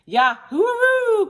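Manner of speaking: high, loud cowboy whoop; exultant cry. A narrator's reading. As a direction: holler it out, stretched high. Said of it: "cried Cowboy McCoy".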